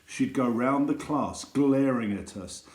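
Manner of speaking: plain reading voice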